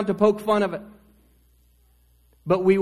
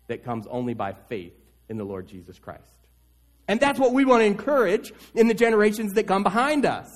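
ordinary talk

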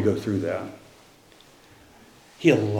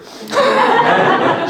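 high, sudden laugh breaking out; a real, high burst of laughter; 4 dB louder than usual. Real, not acted. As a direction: laugh out loud at the memory. Laughs at his own Siri joke.

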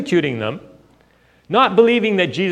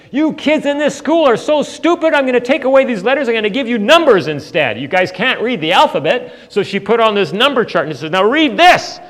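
put-on higher and louder, a nurse snapping at the kids; scolding nurse; 9 semitones higher, 8 dB louder than usual. School nurse berating the kids over the eye chart, loud scolding. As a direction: scold the whole class like a fed-up school nurse. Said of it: "So she was scolding me".

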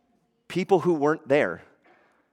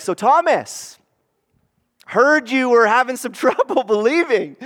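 teasing Jesus; 8 semitones higher, 5 dB louder than usual. Retold or imitated. Jesus voiced teasing Thomas.

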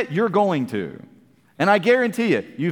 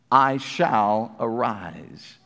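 slow hushed proclamation; 9 semitones lower, much slower than usual. Acted. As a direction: let every word of the promise sink in. Preacher dramatizes the verse.

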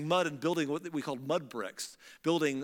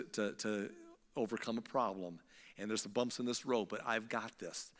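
softer, calm, comforting; God comforting Moses, softer and calm; reassuring God; 5 semitones lower, 6 dB quieter than usual. Retold or imitated. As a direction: settle him down gently.

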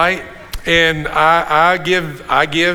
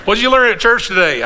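quick, higher-pitched mock question; imagined questioner; 7 semitones higher, 4 dB louder, faster than usual. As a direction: act out someone asking, pitched up and brisk. Imagined after-church exchange about the joke.

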